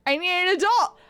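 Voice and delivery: hammed-up shout for help; mock panic. Streamer begging for an adult, mock-panicked shout. Same words escalated into panic.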